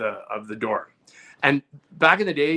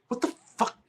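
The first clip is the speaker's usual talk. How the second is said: higher, louder imitated rage; mock furious; 6 dB louder than usual. Brother finding Vaseline on the phone, hammed-up angry outburst. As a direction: act out your brother losing it over a greasy doorknob.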